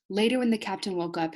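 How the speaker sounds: ordinary delivery, nothing marked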